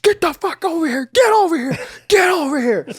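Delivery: high-pitched furious-dad yelling; imitated screaming. Hot-headed dad chasing two kids round the house, screaming. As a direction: scream it over and over, mid-chase. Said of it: "he doesn't scream like that".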